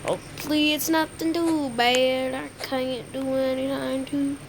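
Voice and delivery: normal talking voice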